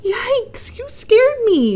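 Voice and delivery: high, startled yikes; startled yelp. A narrator's reading. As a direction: jump in surprise. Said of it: "the swamp monster quacked".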